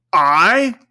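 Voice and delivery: loud, indignant ghost cry; unearthly, offended "I?"; cried out. Acted. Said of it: "cried the spirit".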